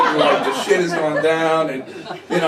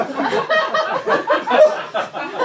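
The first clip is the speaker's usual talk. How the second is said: high giggle. Retold or imitated. Tripping, laughing at stage gear.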